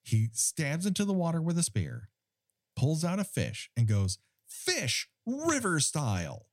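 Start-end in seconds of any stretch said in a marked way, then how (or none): 4.5-6.5 high, drawn-out mock proclamation; grand announcement. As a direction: announce it slowly like a momentous discovery. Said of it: "is announcing something".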